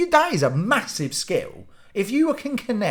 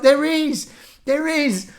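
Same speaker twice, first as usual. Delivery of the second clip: higher, eager insisting; imitating mum, sure she sees it; insistent mum; 8 semitones higher, 3 dB louder, slower than usual. Mum insisting to her son, higher and eager.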